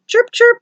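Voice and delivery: high, loud chirps like a car alarm beeping; mock alarm chirp. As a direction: chirp it out like a key fob.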